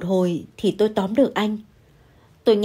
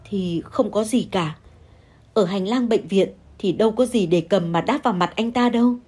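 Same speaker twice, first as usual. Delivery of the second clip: plain reading voice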